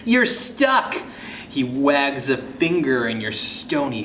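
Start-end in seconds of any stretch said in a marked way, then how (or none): 0.0-1.0 higher gloating taunt; mild taunt. Acted. Ghost John teasing the stone kid, a touch gloating. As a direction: rub it in. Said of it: "laughing and pointing at you".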